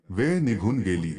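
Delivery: usual speaking voice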